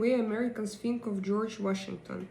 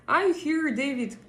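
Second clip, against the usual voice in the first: raised, high call across the room; 6 semitones higher, 4 dB louder than usual. Real, not acted. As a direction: call David in from the chat. Calling out to a viewer again.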